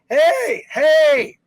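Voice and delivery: high, loud mock yell of hey; mock shout. Host mocks workmen banging during the review, shouting. Mocking the loud theater noise.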